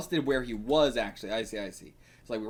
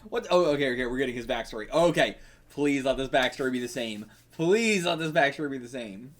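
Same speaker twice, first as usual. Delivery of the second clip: usual speaking voice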